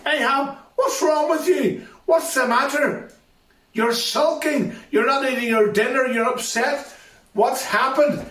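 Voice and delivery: high, fussing queen voice with a laugh; queen coaxing her sulking husband in a high fussing voice. Acted. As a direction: play the queen, pitched high, mock-concerned.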